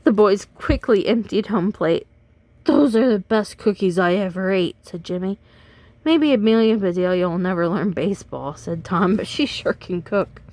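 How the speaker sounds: put-on lively read-aloud for kids; animated storyteller voice for children; storybook reading. A narrator's reading. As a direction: read it like bedtime story time. Reads picture book to children.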